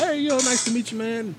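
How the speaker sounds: very high-pitched chummy hello; acted friendly greeting. Friendly guy leaving the restroom, acted chummy greeting. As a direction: imitate your own breezy greeting to a stranger.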